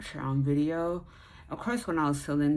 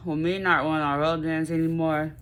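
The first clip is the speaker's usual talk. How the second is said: ordinary talk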